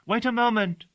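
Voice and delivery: put-on a girl calling out to stop the servant leaving; called out. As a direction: call after the departing servant. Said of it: "she called".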